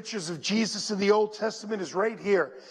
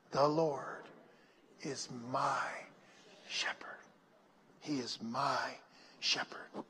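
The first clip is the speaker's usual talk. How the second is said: hushed, low phrases with long pauses; soft meditative mutter; 6 semitones lower, 10 dB quieter than usual. Shown as a demonstration. Preacher leading the church in meditation, soft muttering. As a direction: murmur it under your breath, dropping to a whisper. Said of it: "say it softly and you mutter it out".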